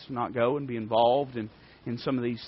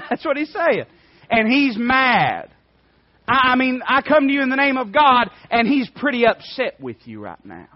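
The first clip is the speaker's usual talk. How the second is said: preaching at full force; 10 semitones higher, 9 dB louder than usual. Acted. Preacher voicing David taunting Goliath, exultant shout. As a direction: roar it with a grin.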